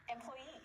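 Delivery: employ-EE, stressed at the end; final-syllable stress. Shown as a demonstration. Teacher modelling a word to repeat, last syllable stressed in Employee. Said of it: "It can also be at the end".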